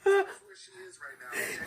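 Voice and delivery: high delighted laugh; prank reveal laughing. Real, not acted. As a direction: burst out laughing.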